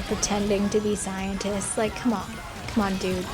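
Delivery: ordinary talk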